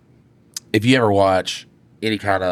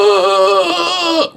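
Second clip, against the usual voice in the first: loud high wailing "aah"; long, high mock scream; screaming cry; 14 semitones higher, 6 dB louder than usual. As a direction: imitate someone running off screaming and crying. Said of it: "took off screaming and crying".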